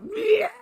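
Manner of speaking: high-pitched imitated fangirl squeal; mock frenzied shriek; mock fan scream. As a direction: squeal like a Beatlemania crowd. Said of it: "all these women were like".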